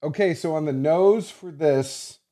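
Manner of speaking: mock Zeke reading notes with head turned away; mumbled off-mic. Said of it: "and you can't hear him".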